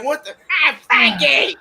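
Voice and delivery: high mock yell of an angry Chicago grandma. Grandma yelling at dad Frankie, high mock yell. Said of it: "she's yelling".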